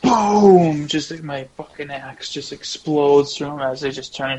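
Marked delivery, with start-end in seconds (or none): 0.0-0.9 loud, drawn-out boom; booming explosion. Acted. Player relishing the axe exploding through kobolds, booming shout. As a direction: shout it like a blast going off.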